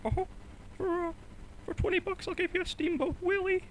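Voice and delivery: halting high mouse impression; Mickey Mouse. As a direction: make a hesitant offer in squeaky falsetto. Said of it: "do the Mickey Mouse impression".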